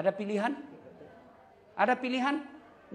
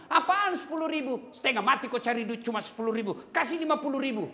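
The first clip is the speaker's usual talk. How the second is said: angry wife; 6 semitones higher than usual. Retold or imitated. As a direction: snap back at the husband's stingy allowance. Wife protests husband's allowance.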